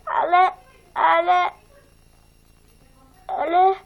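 whimpering, pleading but; whiny protest. Acted. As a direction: beg your way out, half crying. Pupil protests being punished.

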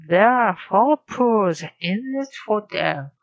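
loud, slow repeat of the model line with "four" stressed; loud, emphatic repeat. Shown as a demonstration. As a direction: shadow the model line loudly and slowly. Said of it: "learner repeats the model line".

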